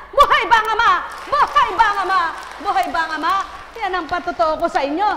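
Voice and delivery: shouted high and loud; loud preaching shout. Acted. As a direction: shout it out to the church. Preacher calling to crowd.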